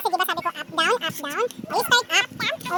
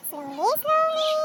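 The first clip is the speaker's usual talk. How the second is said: drawn-out words, lifted in pitch; high, stretched guiding chant; slow coaxing; 6 semitones higher, much slower than usual. Real, not acted. Parent paces child's tracing.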